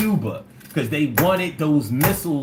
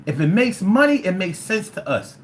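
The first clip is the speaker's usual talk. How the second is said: normal talking voice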